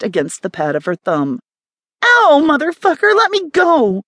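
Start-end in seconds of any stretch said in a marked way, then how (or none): 2.0-4.0 much higher, louder yelp of pain; woman pricked by a test needle yelling; pained shout. A narrator's reading. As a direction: yell out at the sting.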